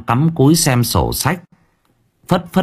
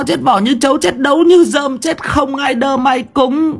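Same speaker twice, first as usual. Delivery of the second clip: cursing wife; 6 semitones higher than usual. Acted. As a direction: rail at the unseen chicken thief. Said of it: "tiếng của vợ ông cứ thế mà choa choa".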